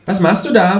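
a high, cheeky kangaroo asking. Acted. Kangaroo asks the narrator abseiling from the balcony, high and cheeky. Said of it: "fragt das Känguru".

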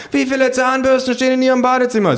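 very high, loud questioning voice; shrill voice from a survey mob; badgering pollster. A narrator's reading.

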